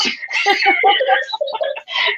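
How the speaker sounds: shrieking high laughter. Real, not acted. Laughing at own story.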